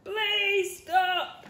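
pleading. Acted. Brother pleads.

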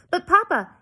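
put-on high, loud girlish protest; pleading protest. A narrator's reading. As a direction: object to your father, voice leaping up. Daughter protests father's refusal.

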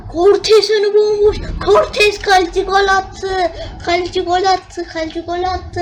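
screaming goal call, high and loud; wild goal celebration shout. Real, not acted. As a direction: yell it like a commentator losing it. Goalkeeper scores, he screams.

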